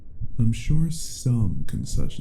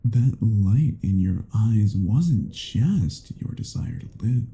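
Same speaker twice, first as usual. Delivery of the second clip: normal talking voice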